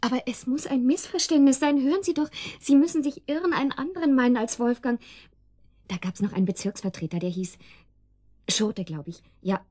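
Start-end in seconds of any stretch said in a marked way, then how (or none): 0.0-5.0 higher, louder desperate protest; frantic insistence that he's wrong; agitated pleading. Acted. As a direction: push back against the bad news.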